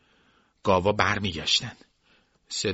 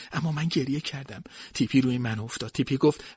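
plain reading voice

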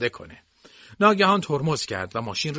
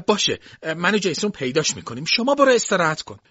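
higher voice, lightly acted urging; insistent servant; 7 semitones higher, 4 dB louder than usual. A narrator's reading. Servant sends the mistress off to rest, mildly insistent. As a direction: hurry her off, rising and louder at the end.

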